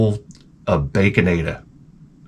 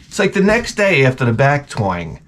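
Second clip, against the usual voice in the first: ordinary delivery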